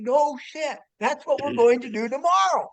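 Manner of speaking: high, loud mock exasperation; exasperated retort. Friend re-enacting his retort to dad about tomorrow's hills, exasperated. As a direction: fire it back, pitch leaping right up.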